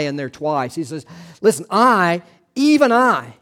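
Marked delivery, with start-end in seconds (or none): 1.4-3.3 "even I" pushed higher and louder; emphatic I. Shown as a demonstration. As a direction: stress "I" like God insisting. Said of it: "read it with some emphasis".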